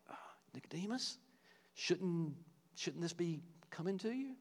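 soft, gentle, unhurried question; a kind nudge, not a rebuke; kindly. Retold or imitated. Jesus kindly nudging Nicodemus, soft gentle question. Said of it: "kind of kindly saying that".

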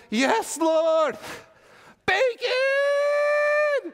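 high, loud squeal of delight; gleeful Peter. Pretend. Peter overjoyed at the bacon, shouting high. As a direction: shriek it like a kid spotting treats. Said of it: "Peter going".

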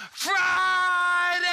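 high, loud yelled call. Retold or imitated. Preacher yelling the call to the crowd, loud. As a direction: throw it out with everything. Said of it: "leaned back and yelled".

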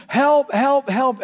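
high, loud cry of sheer terror; screaming for help. Retold or imitated. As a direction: call out as if people are going under. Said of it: "I begin to scream".

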